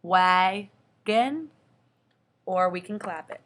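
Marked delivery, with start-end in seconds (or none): 0.0-1.5 wagon said much louder, rising at the end; teacher stressing wagon while checking; emphatic demo. Shown as a demonstration. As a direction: punch out wagon for the chin check. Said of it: "with our chin".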